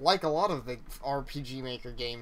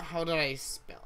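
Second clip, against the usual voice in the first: normal talking voice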